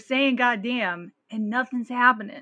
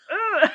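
high loud disgusted groan through a laugh; disgusted ugh; 12 semitones higher, 8 dB louder than usual. Retold or imitated. Retelling a comic's joke to co-host, mock disgusted ugh. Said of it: "You know, like".

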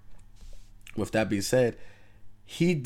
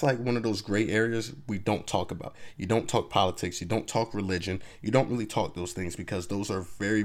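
usual speaking voice

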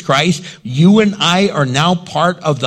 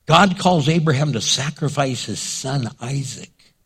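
usual speaking voice

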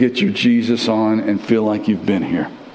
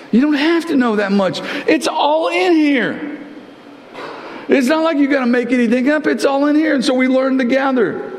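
fiery sermon delivery; preaching at full force; 11 semitones higher than usual. Acted. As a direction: press the point home hard.

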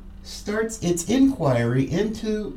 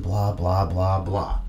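dismissive blah blah; 10 semitones lower than usual. Real, not acted. Dismissing diplomatic talk.

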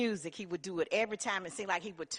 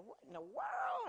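surprised gasp; 9 dB quieter than usual. Retold or imitated. Laura reacting to radio.

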